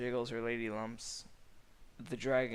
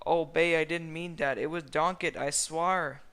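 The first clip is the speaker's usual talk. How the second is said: pleading; 5 semitones higher, 8 dB louder than usual. A narrator's reading. Shrek pleading.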